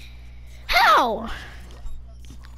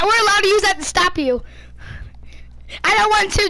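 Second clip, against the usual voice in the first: ordinary delivery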